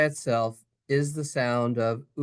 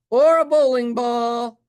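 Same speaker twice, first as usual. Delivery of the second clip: higher and louder, drawn out for fun; silly outburst; 8 semitones higher, 8 dB louder than usual. Pretend. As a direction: blurt it out like a goofy joke. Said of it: "sudden silly outburst".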